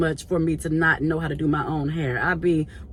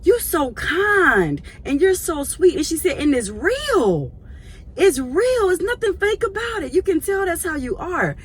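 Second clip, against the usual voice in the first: put-on tender elderly praise; 10 semitones higher than usual. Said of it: "she was like".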